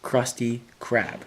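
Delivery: Krusty Krab, slow, with each hard k of "Krusty Krab" punched; slow Krusty Krab that spells out the k sounds; alliteration demo. Shown as a demonstration. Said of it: "You could hear the k sound repeated".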